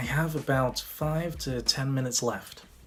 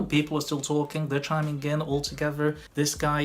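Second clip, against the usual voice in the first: ordinary delivery, nothing marked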